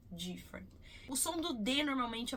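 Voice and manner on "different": different beginning dji, lips rounded; the wrong different, dji-ferent, softly; Brazilian dji sound. Shown as a demonstration. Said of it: "E não different".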